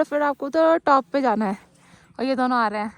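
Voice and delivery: ordinary talk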